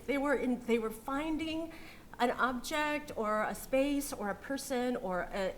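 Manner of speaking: ordinary delivery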